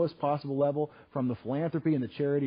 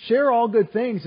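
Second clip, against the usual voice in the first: louder, higher, slow emphasis; emphatic quoting; 9 semitones higher, 10 dB louder, slower than usual. Real, not acted. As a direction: lift and stretch the verse. Emphatic quote of the verse.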